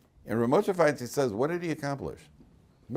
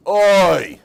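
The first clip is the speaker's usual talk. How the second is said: a big collective wail of oi; loud groaning oi; 10 semitones higher, 15 dB louder than usual. Retold or imitated. A crowd groaning at bad news with a loud oi.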